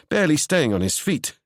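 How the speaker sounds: usual speaking voice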